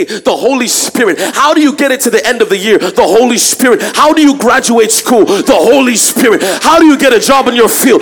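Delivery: loud, high, building shout; preaching climax. Acted. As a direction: build to the peak with each question.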